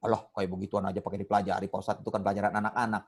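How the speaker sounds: low, soft, fast grumble; dismissive adult student. Acted. Ustadz acting a student who thinks it childish, quick low dismissal. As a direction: voice a scoffing learner under the ustadz's level.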